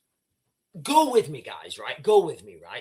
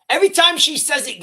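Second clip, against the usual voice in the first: laughing; 9 semitones higher, 7 dB louder, faster than usual. Real, not acted. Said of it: "I can't stop laughing".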